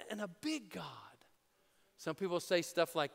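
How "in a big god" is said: sudden slow whisper. Real, not acted. Preacher to congregation, whispering the point. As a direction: drop to a hushed slow whisper for emphasis.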